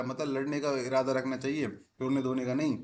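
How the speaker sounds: normal talking voice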